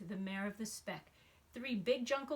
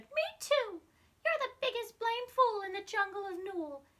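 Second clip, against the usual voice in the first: put-on much higher, louder squeaky baby voice; young kangaroo; 12 semitones higher, 7 dB louder than usual. Baby kangaroo in the pouch mocking Horton, high squeaky voice. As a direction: pipe up like a tiny joey copying mum. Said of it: "the young kangaroo in her pouch said".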